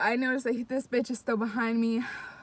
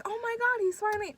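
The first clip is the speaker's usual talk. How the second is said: hopeful relief; 11 semitones higher, faster than usual. Retold or imitated. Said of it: "I was like".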